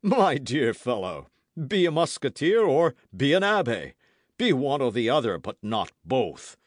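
firm, emphatic musketeer; admonishing Porthos. A narrator's reading. Porthos lectures Aramis on his two callings, admonishing. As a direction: lay it down firmly, slowing at the ends.

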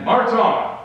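loud, high barked order; crying out. Acted. Captain crying out an order to his men. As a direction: call the men onward sharply. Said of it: "crying out to his men".

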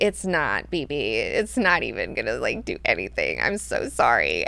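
low-pitched, patronizing retort; mock pity. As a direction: let the condescension sink deep and low. Voices Leto pitying Duncan's threat.

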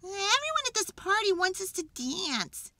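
high, whiny character voice; worked-up animal protest; exasperated complaint. A narrator's reading. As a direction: complain with pitch pushed right up. Jim complains to his friends.

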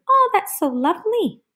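high, sweet gushing; admiring onlookers. Acted. People over here admiring her work, high gushing voice. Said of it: "some people over here are thinking".

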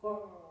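playful growl; mock tiger growl. Tiger noise in story.

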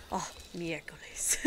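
miércoles muttered low and soft like oh my God; muttered exclamation. Retold or imitated. Walking in the kitchen, softly muttering miércoles like oh my God. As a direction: sigh out Oh, miércoles as a mild curse. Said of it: "like, almost, like "Oh my God" kind of thing".

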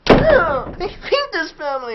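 put-on sulky kid shouting under his breath; muffled yell. Shown as a demonstration. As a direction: shout it but keep it hushed. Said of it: "yell back at them, but you don't want them to hear it".

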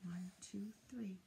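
soft whispered counting; hushed recount. Acted.

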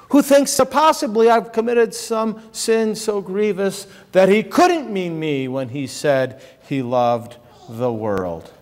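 ordinary talk

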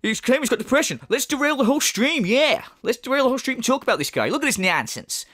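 high, quick, jeering mimicry; excitable mock outrage; mocking imitation. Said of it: "You're like".

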